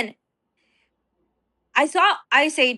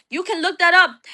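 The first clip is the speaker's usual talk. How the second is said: normal talking voice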